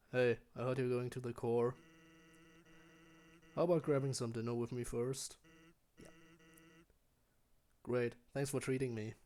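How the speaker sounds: lightly voiced lazy skeleton, easygoing; a hint of a relaxed joker; Sans voice. Player hears a lightly voiced Sans invite him to dinner. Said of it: "I'm quite there yet with the Sans voice".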